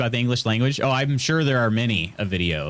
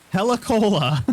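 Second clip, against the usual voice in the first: amused exclaim; 10 semitones higher than usual. Real, not acted. Spots funny product name.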